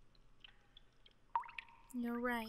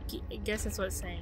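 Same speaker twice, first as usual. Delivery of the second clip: ordinary talk, nothing marked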